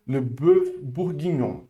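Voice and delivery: le bœuf bourguignon slowly in French; slow French demo of le bœuf bourguignon; French model. Shown as a demonstration. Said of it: "Repete comigo".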